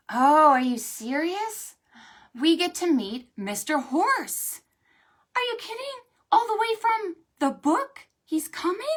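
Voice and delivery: high, loud delighted disbelief; excited storyteller squeals; mock excitement. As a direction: gush over the surprise guest. Excited about visitor for kids.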